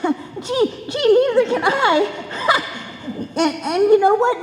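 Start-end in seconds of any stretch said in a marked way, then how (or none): 2.3-2.8 giggle. Acted. Girl giggles at Mister Boy.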